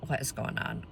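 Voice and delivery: low, near-whispered wondering; hushed puzzlement. Retold or imitated. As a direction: drop to a soft, wary undertone. Puzzled thought at tapping on window.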